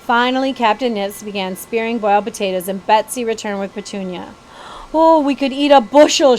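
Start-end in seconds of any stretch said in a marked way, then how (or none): 4.9-6.4 put-on bright, loud girlish exclaim. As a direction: exclaim it with delight. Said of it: "she exclaimed".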